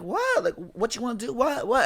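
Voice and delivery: high-pitched retort, squaring up; retold confrontational "what", thrown back high; defiant challenge. Girl answering boy across the table, defiant.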